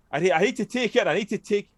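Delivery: high, frantic mock pleading; mock desperate begging. Mocking Harry demanding the cure, high mock panic.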